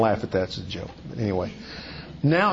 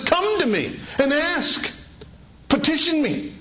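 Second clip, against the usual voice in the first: voicing Jesus; 11 semitones higher than usual. Preacher voicing Jesus' invitation.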